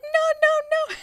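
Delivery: high, loud panicked shouting; frantic instructor no. Retold or imitated. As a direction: cry out in alarm to stop her. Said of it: "all you hear is the instructor. He's like".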